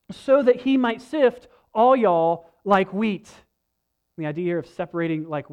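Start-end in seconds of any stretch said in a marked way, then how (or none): 0.1-3.3 slow, folksy Southern phrasing; light Southern accent, playful. Retold or imitated. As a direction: keep the down-home Southern preacher voice. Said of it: "our kind of rednecky version".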